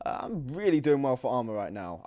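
ordinary delivery

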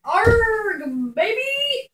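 high, loud pirate cry; pirate shout. Acted. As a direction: bellow it like a cartoon pirate. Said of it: "you're supposed to be saying "arg".

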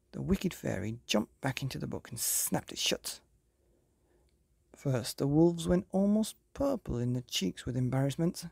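ordinary delivery

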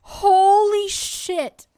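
high, loud, long drawn-out; shocked exclamation. Real, not acted. Shock at the death.

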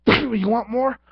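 high, loud playful taunt; mock taunt. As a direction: goad them cartoonishly. Taunting the beaten foe.

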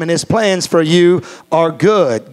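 usual speaking voice

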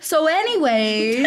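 awkward deflection. Retold or imitated. Woman acting out dodging his love confession. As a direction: brush it off slowly. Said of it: "and I go".

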